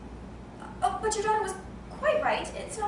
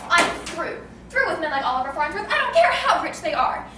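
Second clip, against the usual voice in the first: loud, indignant renunciation; fed up; 6 dB louder than usual. Acted. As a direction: wash your hands of them, voice rising at the end. Hostess renounces rude rich guests.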